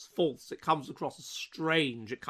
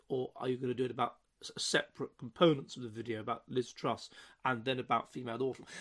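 softer, lower, pedantic questioning voice; friend's odd voice; 4 semitones lower, 7 dB quieter than usual. Retold or imitated. University friend grilling him about the video, in an odd, soft, pedantic voice. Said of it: "the way he spoke was in this very unusual way".

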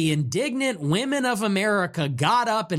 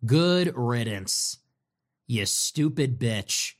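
lower, slow, dismissive goodbye; contemptuous farewell; 6 semitones lower, much slower than usual. Retold or imitated. Host dismissing Marjorie after her statement, slow contempt. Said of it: "I say".

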